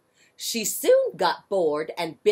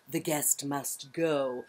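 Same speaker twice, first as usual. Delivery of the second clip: polite dog; 4 semitones lower, 6 dB quieter, slower than usual. Acted. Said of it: "The dog said politely".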